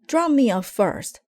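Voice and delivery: put-on high, loud boy's shout. A narrator's reading. Brother shouting on the way to the car. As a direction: demand to go first. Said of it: "shouted Brother".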